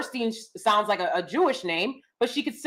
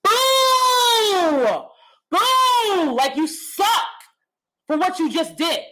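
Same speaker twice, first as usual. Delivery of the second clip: high, loud mocking boos; booing crowd; 6 semitones higher, 3 dB louder, much slower than usual. Crowd booing him after his icebreaker impression. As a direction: boo him off the stage. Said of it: "They were like".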